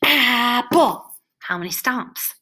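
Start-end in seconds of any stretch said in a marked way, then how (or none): 0.0-1.0 "Apple" in two heavy, loud, higher beats; stomped syllables. Shown as a demonstration. Teacher stomps the word out, "Apple" in two loud beats. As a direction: stomp out "Apple", both parts punched. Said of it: "let's get up and stomp it".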